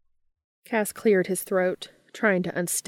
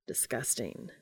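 much lower and softer, deadpan disgust; 7 semitones lower, 11 dB quieter than usual. Acted. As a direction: grimace through the word. Bitter pills, Cass grimacing.

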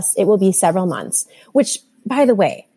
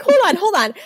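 high-pitched, laughing plea to wait; laughing retold urgency; 10 semitones higher than usual. Mother retelling scrambling to write the diagnosis down, laughing urgency. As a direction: act out a flustered scramble through laughter.

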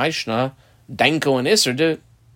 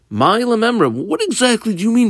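high-pitched, mock-puzzled challenge; challenging question; 8 semitones higher than usual. Rabbi questions Reish Lakish's meaning, challenging. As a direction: push back with a raised-pitch question.

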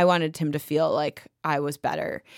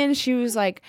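normal talking voice

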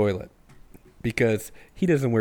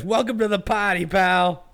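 John McClane quote; 7 semitones higher than usual. Retold or imitated. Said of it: "he says".

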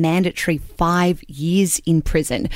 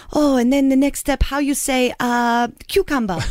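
mock Spanish accent; 7 semitones higher than usual. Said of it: "and she says".

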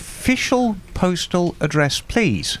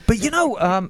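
ordinary talk, nothing marked

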